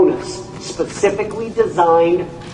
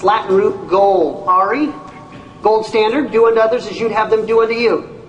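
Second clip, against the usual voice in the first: usual speaking voice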